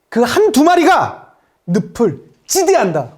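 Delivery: slow, deliberate commander's speech; rally the unit with weighty pauses; acted battle address, mostly low and measured; 이재훈's film role. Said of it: "젊은 이재훈이 나와가지고".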